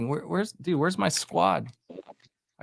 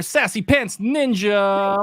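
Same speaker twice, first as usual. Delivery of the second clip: slow, drawn-out winner call; announcer voice; 6 semitones higher, 3 dB louder, much slower than usual. Acted. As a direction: announce it like a race host.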